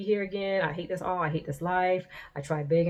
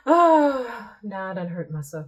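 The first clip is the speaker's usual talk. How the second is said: mock wail; 5 semitones higher, 9 dB louder, slower than usual. Mocking her dramatic text.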